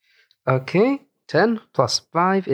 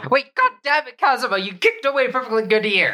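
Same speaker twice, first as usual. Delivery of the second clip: high-pitched indignant outburst; exasperated scolding; 10 semitones higher than usual. Pretend. Player scolds Kazuma over the lost ear, exasperated. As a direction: scold a teammate in mock outrage, pitched way up.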